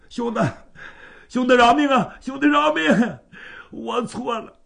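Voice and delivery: high, loud desperate pleading; kneeling cousin begs Bai Yutang for mercy; begging for mercy. Acted. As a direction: grovel for your life.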